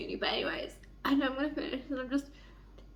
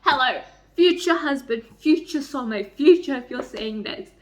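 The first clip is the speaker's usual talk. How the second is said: excited pleading; 8 dB louder than usual. Real, not acted. Pleading to a future husband.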